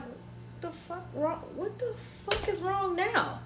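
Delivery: high, hushed, half-whispered disbelief; incredulous disbelief. Retold or imitated. To Miles staring at her on waking, hushed incredulous high disbelief. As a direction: ask it in stunned, breathy surprise.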